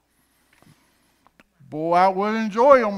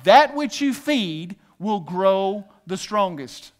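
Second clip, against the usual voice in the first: slow call and response; slower than usual. Shown as a demonstration. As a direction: lead the crowd slowly, "That which you feed" word by word. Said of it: "say this with me".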